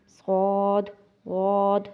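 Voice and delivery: صاد and غاد held long with rounded lips; heavy rounded letters. Shown as a demonstration. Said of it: "بالکل ہم اس کو گولائی میں کرتے ہیں". (صاد، غاد ہونٹ گول کر کے موٹے)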